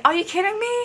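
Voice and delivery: high, playful mock indignation; kidding. Fan showing she was only joking with him, kidding. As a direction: pitch it high without pushing the volume. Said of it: "I'm kidding when I said".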